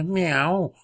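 high rising meows; cat meowing. Acted. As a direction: mew like a cat. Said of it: "maunzte der alte Tom".

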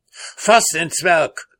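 high, loud hussar's order, drawn out; shouted command. A narrator's reading. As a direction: call out the order slowly and forcefully. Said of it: "schrie der Husar".

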